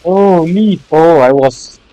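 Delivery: frustrated shout. Real, not acted. Gamer yelling after being hit by the falling rock. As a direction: blurt it out as the hit lands.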